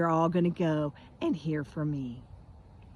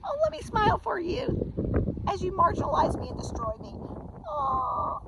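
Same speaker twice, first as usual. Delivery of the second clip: high, sugary mock-obliging voice; sarcastic sweetness; 13 semitones higher than usual. Woman to men demanding smiles, high sarcastic sweet voice. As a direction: simper it in a high fake-cheerful voice.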